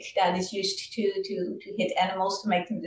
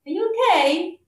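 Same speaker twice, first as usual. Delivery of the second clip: a much higher, small, gentle voice; little voice; 10 semitones higher, 4 dB louder than usual. Acted. Bull asking the depressed Brahmin at his bed, a small high voice. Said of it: "he hears a little voice".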